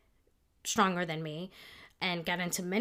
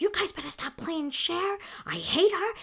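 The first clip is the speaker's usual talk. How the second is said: mock angry outburst; 10 semitones higher than usual. Confronting girls in their face.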